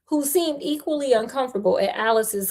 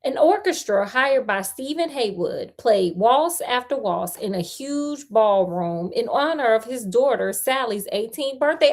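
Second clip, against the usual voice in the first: usual speaking voice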